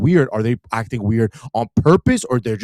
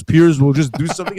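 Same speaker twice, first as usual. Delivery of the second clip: ordinary talk, nothing marked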